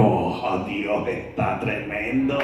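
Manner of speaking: low, hushed and slow, overwhelmed; stunned hush at the hospital clock; awed whisper. Retold or imitated. Awed gasp entering the hospital.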